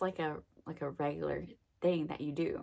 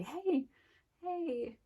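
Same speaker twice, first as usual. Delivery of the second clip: high, sing-song hey across the hall; perky hallway hello; bright greeting; 10 semitones higher than usual. Retold or imitated. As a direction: call out a cheery hello to a friend. Cheerfully greeting friend down hall.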